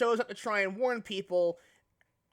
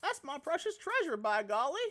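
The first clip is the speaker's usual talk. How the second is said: higher, homespun little critter refusing; a higher country-bumpkin Bidoof guarding his treasure; folksy Bidoof voice; 5 semitones higher than usual. Acted. Bidoof refuses to lend his crystal, higher folksy voice.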